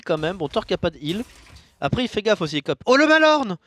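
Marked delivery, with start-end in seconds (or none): 2.9-3.6 high, loud cry of surprise; real burst of amazement; excited outburst. Real, not acted. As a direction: shout the card as it lands. Caster reacts to surprise card.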